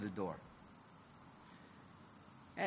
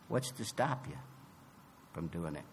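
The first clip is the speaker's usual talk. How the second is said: softer, lower echo of the question; quieter repeat; 10 semitones lower, 10 dB quieter than usual. Real, not acted.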